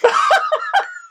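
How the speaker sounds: short high burst of laughter. Real, not acted. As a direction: laugh out at the joke. Laughing at the duo joke.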